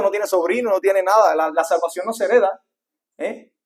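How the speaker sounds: preacher warning the parents, loud and high; full-force preaching. Acted. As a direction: preach it hard to the congregation.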